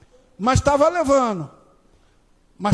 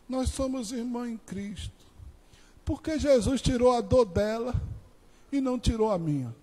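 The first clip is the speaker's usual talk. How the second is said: pained question; 7 dB quieter than usual. Retold or imitated. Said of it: "A pergunta da que tava chorando e doendo muito".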